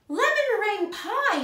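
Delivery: high, loud burst of delight; delighted exclamation. A narrator's reading. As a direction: exclaim it like a wonderful surprise. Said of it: "she exclaimed".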